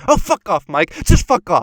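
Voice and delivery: a high, hammed-up "fuck off"; mock angry outburst. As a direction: snap it at Mike with full contempt. A daughter explodes at her father.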